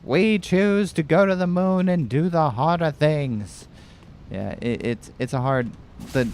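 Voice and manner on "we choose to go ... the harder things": high-pitched Boston-orator accent, hammed up; Kennedy. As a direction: declaim in an attempted presidential New England accent. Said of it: "I can't do the Kennedy accent".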